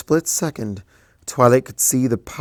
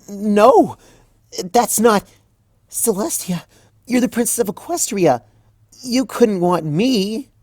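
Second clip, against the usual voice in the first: much higher and louder, near tears; high, loud, anguished student; distraught young mare; 11 semitones higher, 6 dB louder than usual. Acted. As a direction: protest in disbelief, voice cracking upward. Twilight protesting, distraught.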